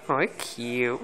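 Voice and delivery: usual speaking voice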